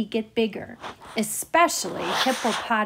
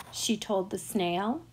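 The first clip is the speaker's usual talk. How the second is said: ordinary delivery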